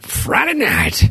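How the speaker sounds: high, slow Southern twang; comic Southern drawl; exaggerated Southern accent. Shown as a demonstration. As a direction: stretch it out in hammed-up Southern. Exaggerating the Southern pronunciation for laughs.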